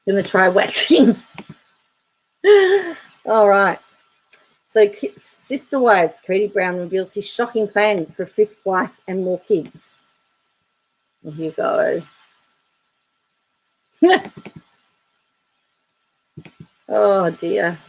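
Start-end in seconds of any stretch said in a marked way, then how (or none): 2.4-2.9 quick high burst of laughter; brief amused laugh; short laugh. Real, not acted. Laughs at a comment. 14.0-14.5 quick high laugh; a sudden amused giggle; short high giggle. Real, not acted. Laughs at a comment.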